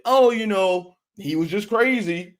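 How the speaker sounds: mock voice of the excuse-makers. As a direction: sneer at the excuse. Mocking people who excuse the killer.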